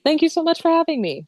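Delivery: high, loud, delighted thanks; gushing thanks. Real, not acted. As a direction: beam out the thank-you. Gushing goodbye on podcast.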